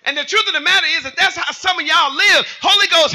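high, rising sermon delivery; full-force preaching. Real, not acted. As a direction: build toward a shouted question.

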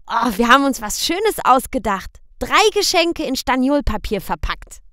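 put-on high, loud little boy's question; high child's voice; child asking. A narrator's reading. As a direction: ask papa like a worried small boy. Boy Michel explains.

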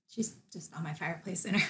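soft whisper, near tears. Real, not acted. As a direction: whisper it, holding back tears. Emotional after scooping mom's ashes.

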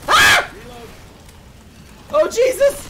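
high, loud shriek of fright; startled scream. Real, not acted. Startled by zombies.

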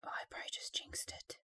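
quick hushed worry about a jinx; fast whisper. Real, not acted. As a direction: rush it under your breath.

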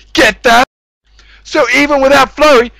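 ordinary talk

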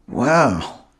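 plain reading voice

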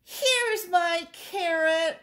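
high, slow, triumphant rabbit voice; delighted rabbit. A narrator's reading. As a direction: announce the find high and drawn out. Rabbit finds carrot in story.

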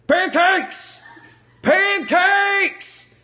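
high, loud yell of pancakes; gleeful shouted catchphrase. Acted. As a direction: belt out Pancakes like a battle cry. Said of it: "that kinda felt good".

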